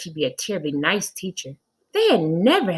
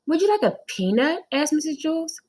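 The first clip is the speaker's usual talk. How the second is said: sweet high lady; 10 semitones higher than usual. A narrator's reading. Reading Mrs Jules aloud.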